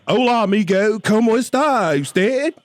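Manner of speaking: high, loud, slow tourist Spanish; mock gringo Spanish. Teasing Joel, high loud slow tourist Spanish. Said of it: "Joel's all".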